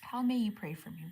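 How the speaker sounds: ordinary talk, nothing marked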